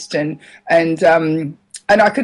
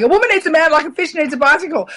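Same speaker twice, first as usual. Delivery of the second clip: mock replay of own joke; 8 semitones higher, faster than usual. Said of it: "And I just roared laughing".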